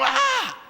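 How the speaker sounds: sudden high cry of fright; terrified scream. Retold or imitated. Preacher imitating disciples' terrified cry. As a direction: scream like men seeing a ghost. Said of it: "ellos exclamaron".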